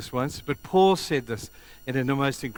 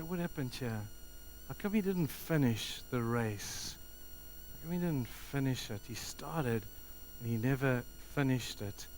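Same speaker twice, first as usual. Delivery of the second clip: soft, lowered-volume questioning; subdued dismay; 8 dB quieter than usual. Retold or imitated. Preacher looking at a lapsed convert, quiet dismay. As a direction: wonder it quietly to yourself.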